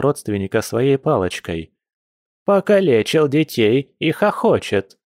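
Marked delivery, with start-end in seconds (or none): 2.4-5.0 high-pitched granny scolding; indignant granny. Acted. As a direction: tell off the new relative, outraged and high. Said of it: "возмутилась бабуля".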